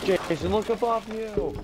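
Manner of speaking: calling out. Real, not acted. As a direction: shout the warning, drawn out. Calling out to teammate.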